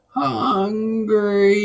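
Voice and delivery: high, loud ghostly shriek. Acted. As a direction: shriek it to scare them off. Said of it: "you shrieked".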